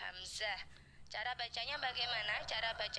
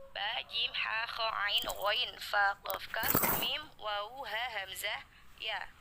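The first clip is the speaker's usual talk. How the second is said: louder, rhythmic recital of the letters; loud letter drill; 6 dB louder, slower than usual. Shown as a demonstration. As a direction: call out each letter clearly, like a class drill. Drills letter list for children. (hafalan huruf dengan suara lantang)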